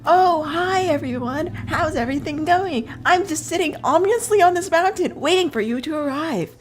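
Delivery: high, loud, mock cheery greeting; fake friendly act. Character faking calm as his killers arrive, high mock-cheery. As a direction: overdo the innocent host. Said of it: "he puts on the act".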